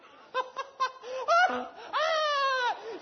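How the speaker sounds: high, loud cackling laugh; imitated mocking laugh. Pastor imitating his wife laughing at him, shrieking laugh. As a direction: mimic her burst of laughter, shrieking. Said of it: "She started laughing".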